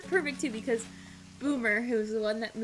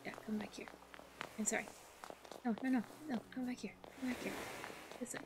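very quiet, near-whispered pleading; hushed little calls; soft coaxing; 2 semitones lower, 13 dB quieter, much faster than usual. Real, not acted. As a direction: coax a wandering animal back under your breath. Softly coaxing villager to return.

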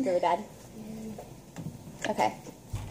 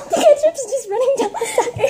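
laughing; 10 semitones higher than usual. Real, not acted. Laughing at ketchup running down.

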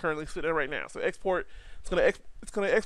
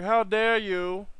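slow, higher, indignant robot voice; mock outraged robot; 4 semitones higher, 3 dB louder, slower than usual. Voicing his robot model, mock outrage. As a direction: play the offended robot, slow and haughty. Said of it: "He's angry".

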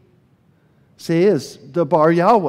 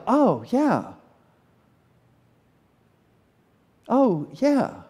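dawning realization; 7 semitones higher, much slower than usual. Retold or imitated. Acting out own startled response.

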